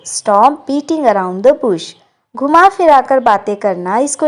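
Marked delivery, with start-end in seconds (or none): 0.1-1.8 slow, clear English model line; slow model sentence. Shown as a demonstration. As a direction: spell it out gently for learners. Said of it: "घुमा फिरा कर बातें मत करो". (धीरे और साफ़ अंग्रेज़ी वाक्य)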